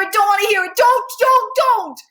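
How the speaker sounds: high, loud desperate cries of don't; panicked screaming. Acted. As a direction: beg in frantic panic, shouting. Max panics in principal's office.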